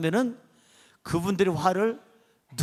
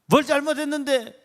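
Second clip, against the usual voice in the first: higher-pitched mock scolding; angry father; 6 semitones higher, 3 dB louder than usual. Father confronting his kneeling son, imitated angry demand. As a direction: demand an answer as a cross father. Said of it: "화가 나가지고".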